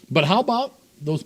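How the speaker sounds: ordinary talk, nothing marked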